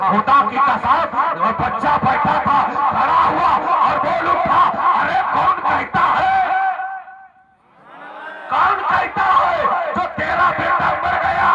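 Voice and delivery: very high, loud rousing cry; full-force preaching. Acted. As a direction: build to a high, ringing challenge.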